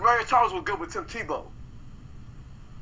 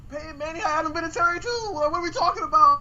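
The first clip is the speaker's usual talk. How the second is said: heated rebuttal; 9 semitones higher, faster than usual. Real, not acted. Heated argument over Vinatieri.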